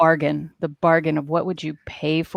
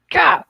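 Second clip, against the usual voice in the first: sudden high frustrated outburst; frustrated gah; 11 semitones higher, 4 dB louder than usual. Retold or imitated. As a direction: let out a sharp exasperated gah. Frustrated writer acting out outburst.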